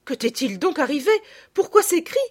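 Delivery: high, urgent mother's voice; alarmed exclamation. A narrator's reading. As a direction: demand answers as a frightened mother. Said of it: "s'écria madame de Rosebourg".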